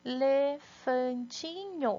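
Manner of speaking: elefantinho drawn out slowly, syllable by syllable. Shown as a demonstration. Teacher to pupils, elefantinho stretched slow. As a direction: stretch out elefantinho so kids hear each piece. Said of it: "Quantos pedacinhos".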